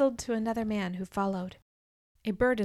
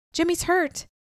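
high, urgent cry of alarm; 8 semitones higher than usual. Acted. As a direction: blurt it out in shock. Sees friend shot, cries out.

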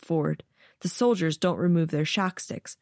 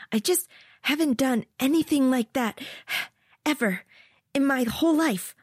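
winded sigh; 8 semitones higher than usual. Acted. Ari sighs, winded.

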